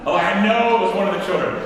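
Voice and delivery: usual speaking voice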